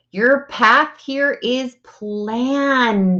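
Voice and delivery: slow emphatic statement. Shown as a demonstration. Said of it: "teaching a principle".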